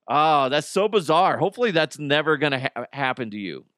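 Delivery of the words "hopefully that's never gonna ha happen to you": normal talking voice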